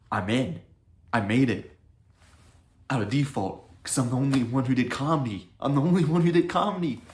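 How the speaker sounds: excited. Retold or imitated. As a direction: celebrate like you just won. Excited about making it.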